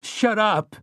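high-pitched angry yell; screaming. Acted. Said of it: "Eddie screamed".

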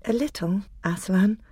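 put-on small, high girl's voice; Susan. A narrator's reading. Susan answers Aslan timidly.

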